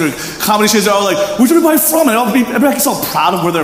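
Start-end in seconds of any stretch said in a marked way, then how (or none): 1.4-2.1 high, loud crowd-pumping shout; hype host call. Retold or imitated. Concert host calling to crowd.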